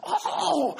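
very high swooping oh; excited exclamation. Retold or imitated. Pastor shows how Ruby cries out mid-sermon, very high. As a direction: leap up into a joyful cry. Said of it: "She does this".

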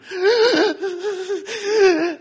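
loud, high gasping sobs; hammed-up uncontrollable child's sobbing; imitated sobbing. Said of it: "Why are you crying?".

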